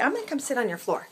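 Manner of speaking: usual speaking voice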